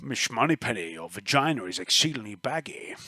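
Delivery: put-on soft, slushy Scottish accent; Sean Connery. Game master showing that sort of quip, Sean Connery accent. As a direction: murmur it low with slurred Scottish sibilants. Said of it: "Sean Connery accent".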